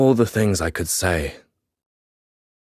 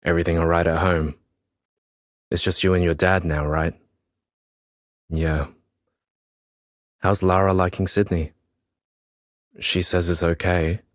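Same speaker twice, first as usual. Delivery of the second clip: brisk, chummy teacher voice; quick, overly casual check-in; matey approach; faster than usual. A narrator's reading. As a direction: play it friendly and casual to draw him out. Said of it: "He tries the matey approach".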